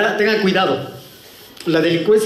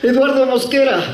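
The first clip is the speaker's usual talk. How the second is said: higher-pitched repeat to be heard; raised, insistent full name; speaking up; 6 semitones higher than usual. Acted. Repeats after "no te oigo.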